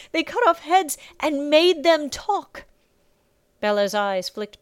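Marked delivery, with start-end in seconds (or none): 0.1-2.7 high, loud, drawn-out wail; slow, tearful horror; sobbing. A narrator's reading. Circe tells of the men's cruelty, sobbing slowly. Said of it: "Circe sobbed".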